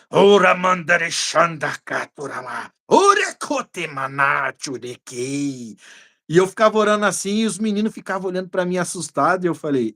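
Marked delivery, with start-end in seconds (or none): 0.1-5.8 slow, drawn-out tongues prayer; speaking in tongues. Retold or imitated. Preacher reenacting his prayer in tongues, slow. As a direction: pray in tongues, slowly and fervently. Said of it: "e eu fiquei orando".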